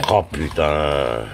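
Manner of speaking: slow, drawn-out low groan of dismay. Real, not acted. Cook groaning at the burnt pan, slow and dismayed. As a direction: let it sag out slowly, crushed.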